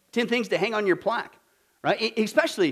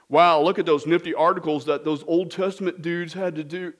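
dopey, lower impressed voice; mock naive reaction; 5 semitones lower than usual. Preacher mocking a shallow reading, dopey wonder. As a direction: gawk like a bored tourist. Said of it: "No, that's not what was going on".

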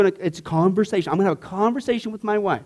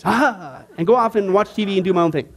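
ordinary talk

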